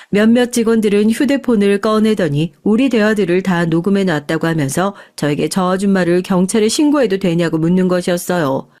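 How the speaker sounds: ordinary delivery, nothing marked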